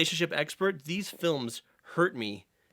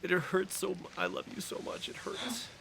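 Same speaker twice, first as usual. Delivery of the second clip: mock anguish; 5 semitones higher, 8 dB quieter than usual. Mock pained confession.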